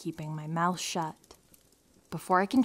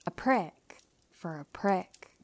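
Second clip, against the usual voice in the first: slow, hushed punchline; smug whisper; 2 semitones higher, slower than usual. Real, not acted.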